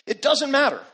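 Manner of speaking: it doesn't matter thrown out loud and high; a preacher's rising cry driving the point to the crowd; preaching shout. Acted. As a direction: hammer the refrain home from the pulpit. Repeated refrain, second take shouted.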